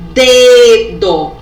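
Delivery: "Dedo" loud, with a long first "De"; sounded-out word. Shown as a demonstration. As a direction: punch and stretch the start of "Dedo". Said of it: "Dedo começa com que letra?".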